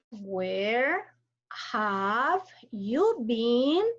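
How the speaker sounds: very slow, rising on been; slow rising question. Shown as a demonstration. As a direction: stretch it out and rise at the end. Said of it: "racing intonation".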